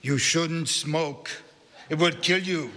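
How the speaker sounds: put-on slow scolding in a Central European accent; old woman's accented Central European warning. A narrator's reading. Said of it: "in a strong Central European accent".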